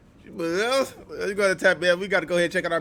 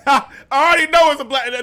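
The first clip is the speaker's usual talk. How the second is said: laughing out the words; 8 semitones higher, 8 dB louder than usual. Real, not acted. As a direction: crack up mid-sentence. Laughing while reacting to clip.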